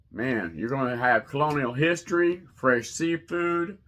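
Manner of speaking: ordinary talk, nothing marked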